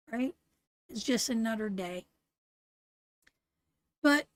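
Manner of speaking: ordinary talk, nothing marked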